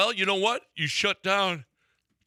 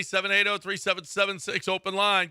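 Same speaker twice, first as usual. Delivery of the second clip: ordinary talk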